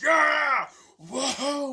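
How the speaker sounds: very high, loud whooping shout; full-throated mock battle whoop; imitated triumphant yell. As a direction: holler like a showman making an entrance. Donkey Kong bursts in yelling.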